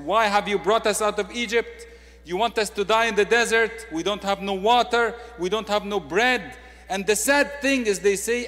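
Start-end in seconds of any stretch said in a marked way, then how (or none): 0.0-6.6 louder, higher mock griping; grumbling complaint. Israelites grumbling at Moses in the desert, a mock complaining gripe. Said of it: "They grumbled against God and said".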